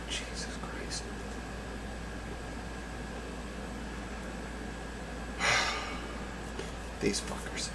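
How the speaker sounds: breathy exasperated whisper. Real, not acted. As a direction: whisper it, worn out. Unvoiced muttering.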